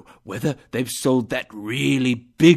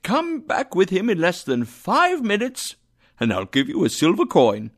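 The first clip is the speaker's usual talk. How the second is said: excited; 7 semitones higher, 4 dB louder than usual. A narrator's reading. Scrooge excited orders.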